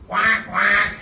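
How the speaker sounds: high, loud quacking like a duck. Shown as a demonstration. Teacher shows the class the duck's noise, loud quacking. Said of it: "I made the noise".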